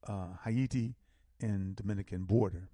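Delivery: usual speaking voice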